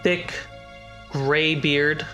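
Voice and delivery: ordinary talk, nothing marked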